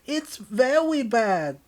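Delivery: put-on very high, loud, slow babyish whine. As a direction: whine it like a toddler. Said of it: "acting like a baby".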